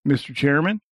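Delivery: rising half-question on "Mister Chairman?". A narrator's reading. Gentleman addressing the chairman, "Mister Chairman?" as a half question. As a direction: say "Mister Chairman?" tentatively, lifting at the end. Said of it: "with a half question in his voice".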